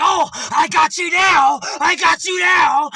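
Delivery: loud, high, slow triumphant yell; gloating shout. Retold or imitated. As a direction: shout it like you've caught him. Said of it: "the guy in the minivan was like".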